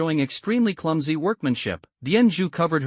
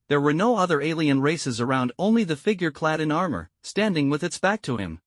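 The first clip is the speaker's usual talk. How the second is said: ordinary delivery, nothing marked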